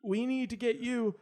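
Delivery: put-on high, slow child voice; a boy who sounds weak and dreamy; kid voice. Kid's high voice in scene.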